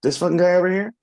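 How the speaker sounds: mock disbelief. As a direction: scoff at the coach about the defender. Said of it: "being like".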